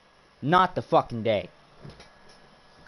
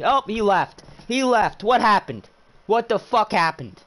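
high, loud disbelief that he left; surprised outburst; 7 semitones higher, 3 dB louder than usual. Real, not acted. Streamer stunned as rival quits lobby, loud disbelief. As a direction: blurt it out, stunned. Racer reacts to opponent leaving lobby.

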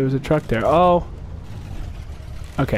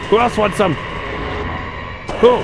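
cocky challenge; 4 semitones higher than usual. Pretend. Player challenging remaining enemies after a win. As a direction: dare the crowd to try you.

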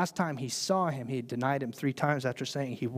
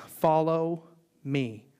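very slow, weighty; slow solemn call; much slower than usual. Acted. Jesus calling Peter, slow and solemn. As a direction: let each word land slowly.

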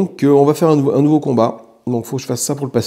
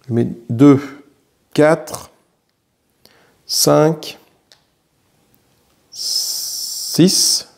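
ordinary talk

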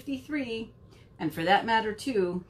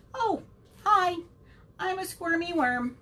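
squirmy worm; 8 semitones higher than usual. Acted. Worm introduces itself.

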